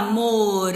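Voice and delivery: "morango" in syllables, with RAN held long; stress on RAN. Shown as a demonstration. Teacher finding the tonic syllable, "morango" with a long RAN. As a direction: sound out "morango", stretching the middle syllable. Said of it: "Morango é paroxítona também".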